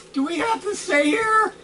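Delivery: a lightly squeaky, worried kobold; high kobold voice. Acted. Frightened kobolds asking each other, high and squeaky. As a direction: pitch up into a nervous little creature. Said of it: "The other kobolds see this and go".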